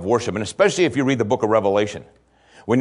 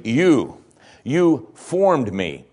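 You set apart, then You formed me very slowly; single out You, drawn out; stress on YOU; 3 semitones higher, much slower than usual. Shown as a demonstration. Preacher stressing who formed David, slow emphasis. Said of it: "He says specifically".

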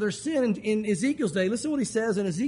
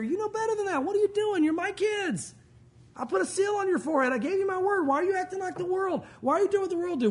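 high-pitched exasperated rebuke; mock upset parent demanding answers; acted parental scolding; 9 semitones higher than usual. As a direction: scold your own kids in disbelief. God scolds his kids like parent.

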